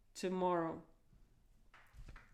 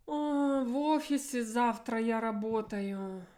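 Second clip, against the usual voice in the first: emotive answer. Shown as a demonstration. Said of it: "reverse word order, which helps me to be more emotional".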